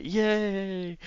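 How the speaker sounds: long, high mock-childish cheer; excited kid. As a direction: stretch it out high, like a thrilled little kid. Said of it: "stereotypical when a kid's excited thing".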